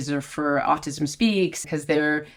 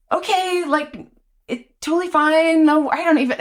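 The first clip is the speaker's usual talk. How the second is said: high, flustered babbling, pitched up; flustered backpedal; 10 semitones higher than usual. Retold or imitated. Caught off guard onstage by Patti, flustered high babbling. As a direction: scramble to smooth it over, voice jumping up high.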